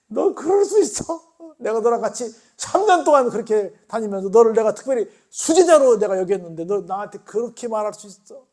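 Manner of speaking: loud, high, hurt rebuke; angry reproach. Retold or imitated. Jesus imagined glaring at Peter, loud angry reproach. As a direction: scold a betraying disciple to his face. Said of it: "무서운 눈초리로 쳐다보겠지".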